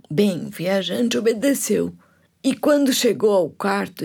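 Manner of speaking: ordinary delivery, nothing marked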